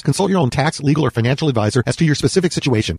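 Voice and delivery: rapid-fire legal disclaimer, loud; fast disclaimer. Acted. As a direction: rattle off the fine print.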